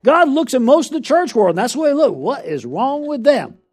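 preacher's rising cry; preaching at full force. Acted. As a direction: press the church with God's question.

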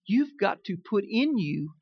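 usual speaking voice

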